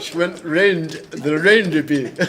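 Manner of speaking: slow, drawn-out mock Scottish accent. Shown as a demonstration. Asked to repeat the cat lady voice, slow Scottish accent. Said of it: "how did she sound".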